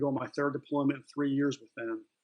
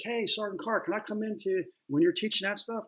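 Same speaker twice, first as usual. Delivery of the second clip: higher-pitched imitation of squad guys asking; lightly voiced, higher-pitched asker; eager request; 7 semitones higher than usual. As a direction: pipe up like a junior Marine asking in. Other squad guys asking to join.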